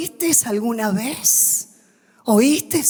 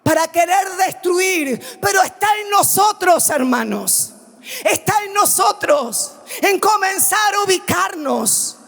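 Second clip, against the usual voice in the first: full-force pulpit preaching; fiery preaching; 9 semitones higher than usual. Acted. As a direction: preach it to the whole church.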